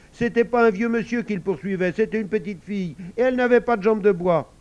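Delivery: put-on loud, high, emphatic child; energetic. A narrator's reading. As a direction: jump in forcefully as a little girl. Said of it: "dit Rachida avec énergie".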